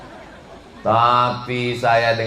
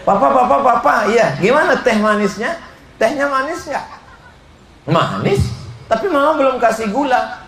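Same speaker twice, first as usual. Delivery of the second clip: much higher, teasing wife voice; flirty wife; 10 semitones higher than usual. Retold or imitated. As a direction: tease the husband sweetly. Wife's sweet talk to husband.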